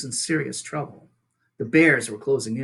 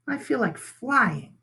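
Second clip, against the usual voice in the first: put-on young gosling; 9 semitones higher, slower than usual. Gosling answers his mother.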